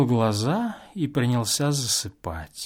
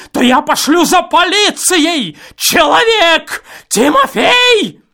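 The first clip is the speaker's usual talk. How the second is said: loud, much higher furious cry; furious shout; 10 dB louder than usual. A narrator's reading. Enraged guest threatens the revellers, furious shout. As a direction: choke on your rage. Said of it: "захлёбываясь от злости".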